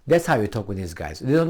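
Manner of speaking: normal talking voice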